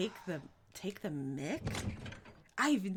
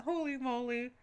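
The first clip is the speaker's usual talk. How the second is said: normal talking voice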